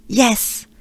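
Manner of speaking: ordinary talk, nothing marked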